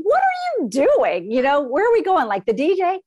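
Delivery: mother puzzled by daughter's odd hand circles, high bewildered voice; baffled inner thought. Retold or imitated. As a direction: act out your confusion at her gesture.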